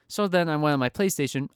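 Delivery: normal talking voice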